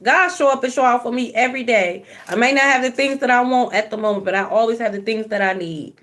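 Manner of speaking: normal talking voice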